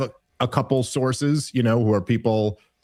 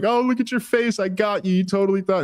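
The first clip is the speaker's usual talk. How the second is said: higher, teasing Michael Scott impression; gloating prankster imitation; 10 semitones higher than usual. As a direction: gloat over the prank victim. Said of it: "he's like".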